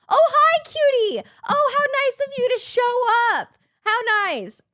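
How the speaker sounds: high, loud sarcastic gushing; mock-sweet greeting. Host calling out Cutie over the prank, mock-sweet sarcasm.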